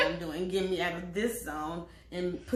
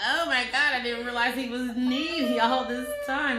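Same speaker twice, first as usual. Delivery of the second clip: louder, dramatic realization; mock shock; 3 semitones higher, 8 dB louder than usual. Real, not acted. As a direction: act shocked at discovering it.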